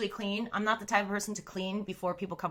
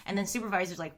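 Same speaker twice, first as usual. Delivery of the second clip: ordinary delivery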